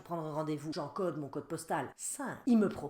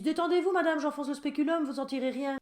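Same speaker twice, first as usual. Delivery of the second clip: high, loud falsely soothing doctor voice; doctor talking down to a patient, mocked; mocking gynaecologist; 9 semitones higher, 5 dB louder than usual. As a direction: send up a patronising doctor. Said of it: "les gynécos qui disent".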